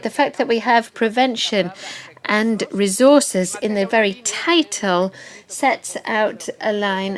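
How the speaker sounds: ordinary delivery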